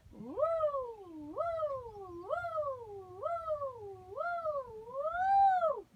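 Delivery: woo sliding from middle up very high; rising vocal glide. Shown as a demonstration. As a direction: follow a line climbing to the top. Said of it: "Here we go in the middle".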